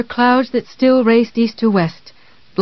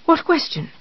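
frightened; 8 semitones higher, 3 dB quieter than usual. Acted. Frightened housekeeper asks.

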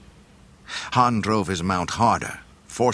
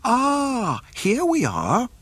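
high, fussy droid voice; prim, precise robot butler; C-3PO; 4 dB louder, much slower than usual. A narrator's reading. C-3PO speaks.

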